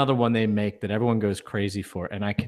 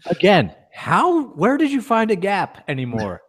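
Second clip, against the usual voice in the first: higher, slow, drawn-out disbelief; teasing disbelief; 10 semitones higher, 5 dB louder than usual. Real, not acted. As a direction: stretch it out, mocking amazement. Co-host teases about the Gap.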